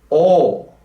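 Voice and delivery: "O" sung rounded and loud. Shown as a demonstration. Teacher modelling a rounded O, sung out loud. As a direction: keep the mouth round on "O". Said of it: "keep that shape with the mouth as an O".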